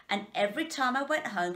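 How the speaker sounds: ordinary delivery, nothing marked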